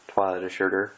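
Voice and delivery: plain reading voice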